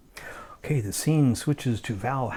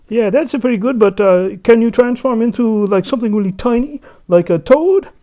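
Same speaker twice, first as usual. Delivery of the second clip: much higher, loud coaxing voice; high sly trickster; 12 semitones higher, 13 dB louder than usual. Acted. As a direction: sweet-talk him into shrinking. Loki tricking Alberic.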